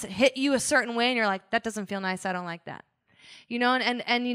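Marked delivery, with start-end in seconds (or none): 1.5-2.8 low, much softer mock complaint; quiet hurt. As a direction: drop low and soft, imitating someone wounded. Acting out someone quietly hurt.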